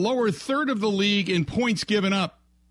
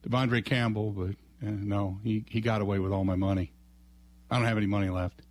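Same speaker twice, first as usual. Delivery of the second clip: sheepish coach; 10 semitones lower, faster than usual. Acted.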